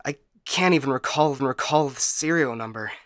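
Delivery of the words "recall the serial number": usual speaking voice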